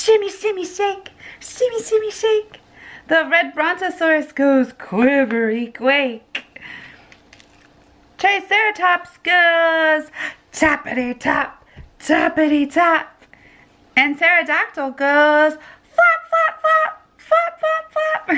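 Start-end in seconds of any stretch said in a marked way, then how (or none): 0.0-2.6 higher, bouncy sing-song shimmy shake; playful high chant. Acted. Mom voicing dancing dinosaur. 15.5-18.3 very high squeaky flap flap; playful very high chant. Acted. Mom voicing pterodactyl flapping.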